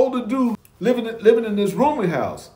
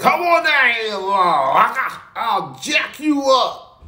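mock angry yell; 2 semitones higher, slower than usual. Drunk Alan downstairs calling out Pete, mock angry yell. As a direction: shout it up the stairs as a dare, slow on the threat. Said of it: "Al was downstairs".

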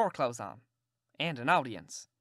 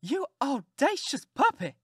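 high, slow outraged cry; an affronted aunt scolding a cheeky boy; indignant aunt; 10 semitones higher, 5 dB louder, slower than usual. Acted. Aunt after being kissed by a stranger, outraged and high. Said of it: "she jumped up and wiped it off".

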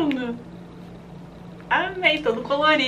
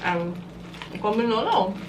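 chewing while talking; speaking around cookie bite; mouth full; 7 semitones lower than usual. Real, not acted. Seen 0: woman bit cookie earlier, jaw working, cookie in hand while speaking.

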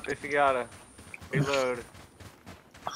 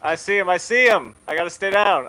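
tense and loud; 5 semitones higher, 11 dB louder than usual. Real, not acted. Under fire, spotting enemy.

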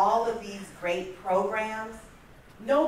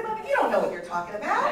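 quick, louder, laughing brush-off; laughing dismissal; 3 semitones higher, 6 dB louder, faster than usual. Retold or imitated. Gina brushing off her mother's criticism, laughing and quick. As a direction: wave it away with a laugh.

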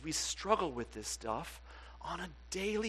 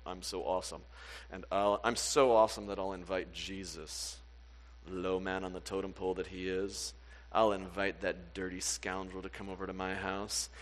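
lower, self-satisfied boast; smug Pharisee; 8 semitones lower than usual. Retold or imitated. Preacher lightly voicing a smug Pharisee, lower and boastful. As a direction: brag like you're above everyone. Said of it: "this Pharisee who was like".

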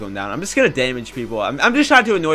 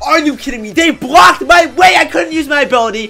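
high-pitched, indignant yelling; annoyed outburst; 9 semitones higher, 5 dB louder than usual. Real, not acted. Gamer blocked from using his ability, annoyed outburst. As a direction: protest loudly at being robbed. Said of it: "I'm so annoyed right now".

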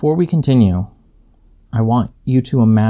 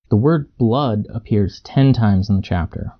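ordinary delivery, nothing marked

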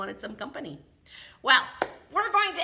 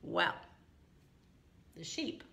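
plain reading voice

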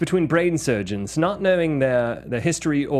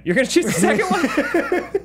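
much higher, laughing in surprise; laughing disbelief; 13 semitones higher than usual. Real, not acted. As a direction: laugh as your point falls apart. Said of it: "wait a minute".

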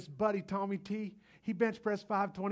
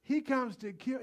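ordinary talk

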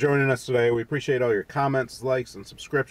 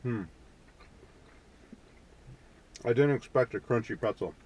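talking between bites; chewing while talking; 2 semitones lower, 4 dB quieter than usual. Real, not acted. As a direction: mouth partly full. Seen 0: man's jaw works after bite; pretzel stick in hand throughout.

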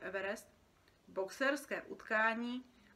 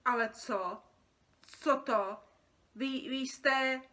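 loud, halting disbelief; shocked stammer; 4 semitones higher, 7 dB louder, slower than usual. Acted. Creature recognising the boy, shocked and stammering. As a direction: stammer as if gasping at the sight. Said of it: "Vytřeštila oči a zalapala po dechu".

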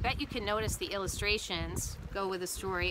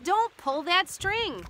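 high, drawn-out urgent warning; urgent cry; 8 semitones higher, slower than usual. A narrator's reading. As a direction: cry it slowly to stop them. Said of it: "cries Frog".

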